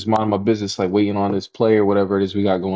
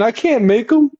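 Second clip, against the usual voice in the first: mock helpless friend; 4 dB louder than usual. Imitating friend's reply.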